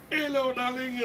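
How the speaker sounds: high, hyped-up sing-song greeting. Retold or imitated. Shopkeeper greeting a regular, hyped up. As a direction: greet them with over-the-top excitement. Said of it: "proper, like, hyped up".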